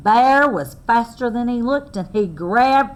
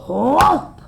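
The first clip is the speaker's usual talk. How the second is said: loud pitched-up whoop; sudden whoop; 6 semitones higher, 5 dB louder than usual. Acted. Storyteller marking Bear's pounce: loud sudden whoop. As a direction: burst it out as the paw lands.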